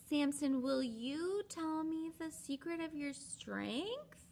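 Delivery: high, slow, sugary coaxing; coaxing Delilah. Retold or imitated. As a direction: wheedle the secret out of him. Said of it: "She's like".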